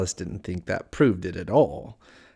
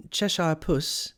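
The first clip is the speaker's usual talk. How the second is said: higher, slow tentative address; a mild touch of timidity, drawn out; 6 semitones higher, slower than usual. A narrator's reading. Alice addressing the Cheshire Cat, mildly timid and slow. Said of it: "she began rather timidly".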